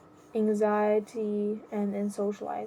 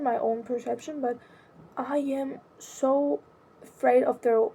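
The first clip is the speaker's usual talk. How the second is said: ordinary talk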